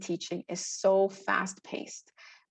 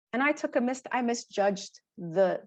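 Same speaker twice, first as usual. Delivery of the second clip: ordinary delivery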